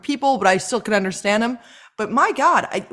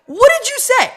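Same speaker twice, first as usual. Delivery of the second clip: incredulous; 7 semitones higher than usual. Retold or imitated. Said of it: "and I'm like".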